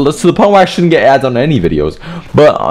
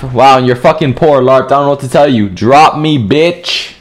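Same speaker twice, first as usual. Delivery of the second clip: normal talking voice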